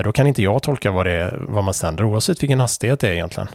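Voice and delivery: usual speaking voice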